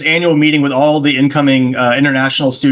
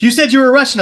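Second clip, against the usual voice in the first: high, louder accusing retort; mock insistent accusation; 10 semitones higher, 5 dB louder than usual. Stranger insisting to him about what he said, mock accusing. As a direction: push the accusation up high and loud.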